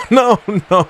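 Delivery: high laughing no, no; giggling disbelief. Real, not acted. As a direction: laugh through the protest. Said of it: "I don't know why I'm laughing".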